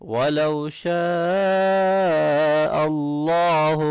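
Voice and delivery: slow high chant; heavy Allah. Shown as a demonstration. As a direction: sing شَاءَ long then a heavy اللَّهُ. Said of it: "اللہ کے نام کو موٹا پڑھنا ہے".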